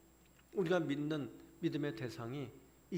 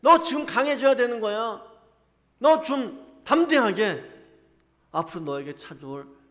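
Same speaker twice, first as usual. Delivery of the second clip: much louder, higher, slow commanding call to be strong; preacher voicing God loudly rousing a weak prophet; God's loud exhortation; 8 semitones higher, 16 dB louder than usual. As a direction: thunder God's command to take courage. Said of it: "하나님 지금 그렇게 우리에게 말씀하고 계시는 거예요".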